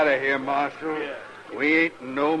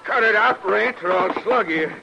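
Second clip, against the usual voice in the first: loud, high threat; sharp warning; 9 semitones higher, 4 dB louder than usual. Acted. Cowboy warning his drunk friend while keeping his gun, loud and sharp. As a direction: bark him down as you grab the gun.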